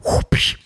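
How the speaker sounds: unvoiced whoosh and crack of a whip; whip crack sound. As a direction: crack an imaginary whip with your mouth. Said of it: "with the whip and the vaqueros".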